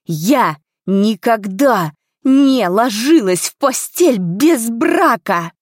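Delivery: slow, loud, each word driven home; forceful, drawn-out public denial; booming declaration. A narrator's reading. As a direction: proclaim it emphatically to the whole crowd. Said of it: "усилив голос магией".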